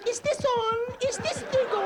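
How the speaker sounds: very high squealed questions; mock screaming. Prisoner screaming in the cell at night, comic squeal. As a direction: squeal it far above your own voice.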